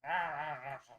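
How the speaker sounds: put-on loud grumpy old man grumbles; old man grumbling. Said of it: "a grumpy old man grumbling under his breath".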